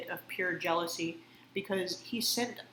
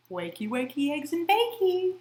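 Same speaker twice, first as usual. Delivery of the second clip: high, singsong mock cheer; sing-song wake-up call; 7 semitones higher, 4 dB louder than usual. Woman mimicking her mom's morning wake-up chant. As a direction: chirp it like a too-cheerful mom waking a kid. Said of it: "she would always say that".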